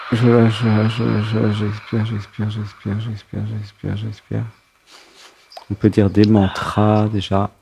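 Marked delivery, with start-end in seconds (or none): 0.1-4.4 repeated mantra fading to near silence. Acted. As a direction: let each repeat fade softer and softer. Breathing release mantra.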